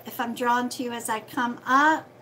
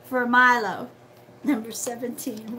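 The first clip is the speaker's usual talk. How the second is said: usual speaking voice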